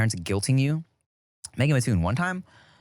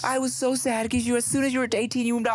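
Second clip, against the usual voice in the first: high, plaintive mother impression; mom's drunken venting; 11 semitones higher than usual. Tipsy mother repeating her guilt trip, imitated high lament. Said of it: "She was like".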